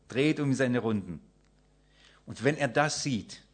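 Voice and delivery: ordinary delivery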